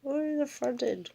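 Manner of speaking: mock prissy cat. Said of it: "So she's like".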